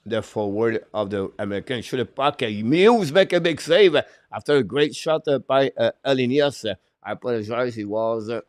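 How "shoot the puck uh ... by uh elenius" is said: excited save call. Real, not acted. Commentator calling a big save on a shot. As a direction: voice jumps up loud for the big save.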